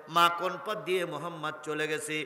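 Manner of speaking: usual speaking voice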